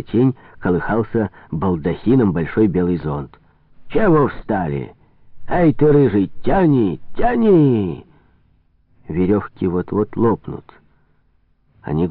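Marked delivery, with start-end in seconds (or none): 3.9-8.1 higher-pitched impatient calling out to haulers; drawn-out shouted command to pull; barking orders. Acted. Fat boss to the men hauling his boat, calling out orders.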